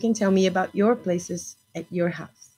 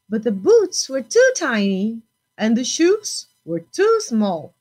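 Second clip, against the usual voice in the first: lively storybook reading; picture-book voice for a class; reading to children; 4 semitones higher than usual. Acted. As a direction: read it bright and expressive for little ones. Teacher reads picture book aloud.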